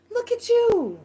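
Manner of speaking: very high mock-cooing tease; high-pitched teasing. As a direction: coo it high, poking fun. Teasing the man, repeated higher.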